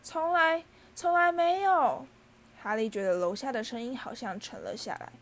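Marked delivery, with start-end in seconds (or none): 0.0-2.1 high, loud, drawn-out elf wail; wailing cry. A narrator's reading. House-elf who was never offered a seat, wailing. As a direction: cry out overwhelmed, stretching the words. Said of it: "他哭叫道".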